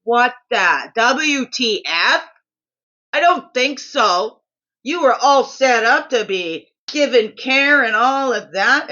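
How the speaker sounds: loud, slow, disbelieving; loud, slow 'what the WTF' in disbelief; exasperated outrage. Retold or imitated. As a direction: let the indignation spill out, drawn out. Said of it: "I was like".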